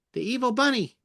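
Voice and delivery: high-pitched evil bunny; squeaky villainous rabbit. Shown as a demonstration. Voicing evil bunny character.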